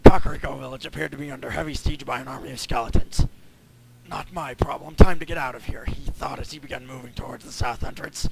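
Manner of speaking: rough, raspy reading voice. Acted. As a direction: rasp every line out. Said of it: "raspy voice".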